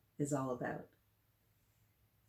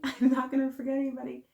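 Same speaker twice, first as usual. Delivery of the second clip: laughing; 7 semitones higher, 6 dB louder, faster than usual. Real, not acted. Laughs while greeting kids.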